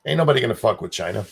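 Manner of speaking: usual speaking voice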